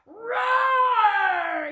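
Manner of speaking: loud high roar of joy; happy lion roar. Acted. Freed lion roaring with joy, loud. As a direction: roar out in relief. Lion roars when free.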